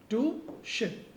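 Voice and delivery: टू शिप said very slowly and deliberately; slow emphasis. Shown as a demonstration. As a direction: spell out टू शिप word by word. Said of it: "this is correct". (धीरे-धीरे ज़ोर देकर टू शिप)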